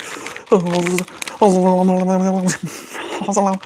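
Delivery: wild giddy noises; giddy caffeinated babble. Real, not acted. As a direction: babble giddily, wired on caffeine. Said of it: "I'm really caffeinated right now".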